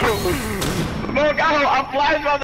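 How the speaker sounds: loud callout; calling out. Real, not acted. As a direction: shout it to the squad. Calling out grenades in game.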